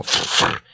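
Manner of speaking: breathy snorting fyr; cat snort. A narrator's reading. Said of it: "зафыркала". (фырканье кошки)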